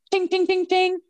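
high, quick run of tings; imitated lock clicks. Woman recalling the cubicle doors locking, high imitated tings. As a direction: sing out each lock as a high ting.

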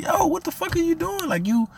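high, indignant retold confrontation; exasperated retold scolding; going in on her. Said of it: "I'm going in on".

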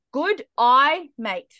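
exaggerated Australian "Good eye, mate"; Australian accent. Shown as a demonstration. Teaching guys an Australian accent trick, exaggerated Australian accent. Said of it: "in an Australian accent".

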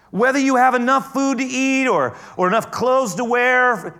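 loud, high, driving sermon voice; full-force preaching. Acted. Pastor preaching to his congregation, full force. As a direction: preach it to the back row.

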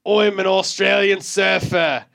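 loud, slow hammed-up Australian surfer; bad Australian accent. Brother at the bar hams up a terrible Australian accent. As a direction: shout it like a fake Aussie surfer. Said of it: "the worst accents of all time".

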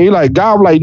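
ordinary delivery

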